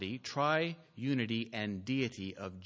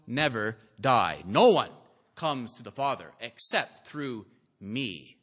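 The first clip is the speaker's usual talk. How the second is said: loud, raised declaiming of scripture; bold proclamation; 4 semitones higher, 6 dB louder than usual. Acted. Preacher quoting Jesus' claims about himself to the congregation, bold proclamation. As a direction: declare each claim forcefully, leaning on key words.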